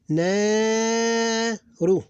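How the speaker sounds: "Na" held long and high, "ru" short; extended syllable. Shown as a demonstration. Teacher showing syllable breaks, long "Na", short "ru". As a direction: stretch "Na", then a quick "ru". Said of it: "One syllable, two syllables".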